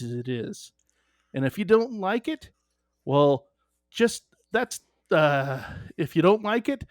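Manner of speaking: folksy UP car-salesman pitch, lilting; Upper Peninsula accent. Acted. Salesman pitching Gustafson Motors in a podcast sponsor spot, UP accent. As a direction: lay on a sing-song Upper Peninsula accent. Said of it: "if you're going to use the U P accent".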